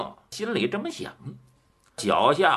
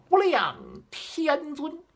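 high-pitched call; 10 semitones higher than usual. A narrator's reading. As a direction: call it out high, without extra volume. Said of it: "高声叫道".

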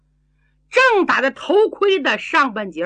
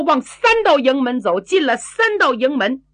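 ordinary delivery

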